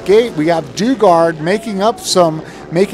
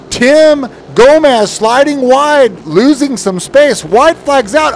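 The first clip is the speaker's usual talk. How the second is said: excited; 7 semitones higher, 4 dB louder than usual. Real, not acted. Tight finish being called.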